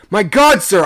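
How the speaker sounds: high, loud exclamation; alarmed shout. A narrator's reading. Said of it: "I shouted".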